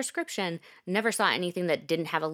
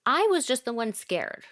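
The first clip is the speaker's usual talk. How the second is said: normal talking voice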